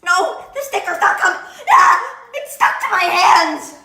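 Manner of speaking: loud laughing frustration. Real, not acted. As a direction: yell through laughter, sticky hands. Sticker stuck to hands.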